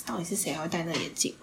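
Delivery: whispering a private mockery; whispered thought. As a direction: hush it like a secret jab. Inner thought mocking the glasses.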